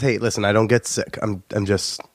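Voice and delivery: ordinary talk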